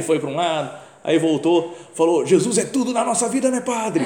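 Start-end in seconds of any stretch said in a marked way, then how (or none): 2.2-4.0 high, loud, eager holler; imitated shouting. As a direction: mimic a zealous man yelling across the bar. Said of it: "Gritando assim".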